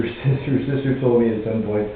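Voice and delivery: ordinary delivery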